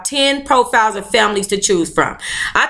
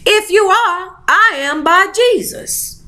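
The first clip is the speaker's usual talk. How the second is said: higher, drawn-out motherly voice; mom's retort; 7 semitones higher, slower than usual. Acted. Mom on learning of the pregnancy, high drawn-out retort. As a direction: draw it out as an indignant mother. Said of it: "she said".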